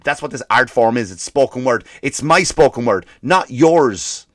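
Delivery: ordinary delivery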